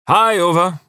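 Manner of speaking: high, loud, chirpy hello; cheerful call. A narrator's reading. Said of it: "he called out cheerfully".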